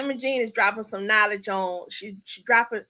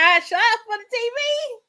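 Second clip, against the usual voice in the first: showing out; 10 semitones higher, 3 dB louder than usual. Real, not acted. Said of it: "Y'all know what I'm saying?".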